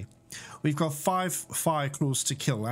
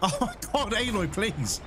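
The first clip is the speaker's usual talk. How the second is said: high panicked plea; pleading in a rising panic; panicking; 5 semitones higher than usual. Real, not acted. Gamer panicking as a machine charges him. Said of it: "Sorry, I'm panicking".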